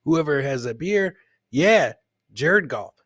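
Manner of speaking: normal talking voice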